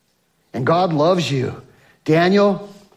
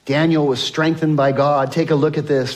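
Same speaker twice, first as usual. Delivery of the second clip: usual speaking voice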